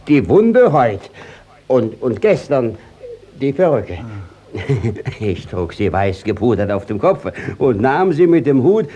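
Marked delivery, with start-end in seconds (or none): 4.5-5.2 short embarrassed chuckle; nervous laugh. Acted. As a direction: laugh off the awkward moment. Judge caught out, laughs it off. (verlegenes Lachen)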